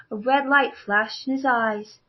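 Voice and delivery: usual speaking voice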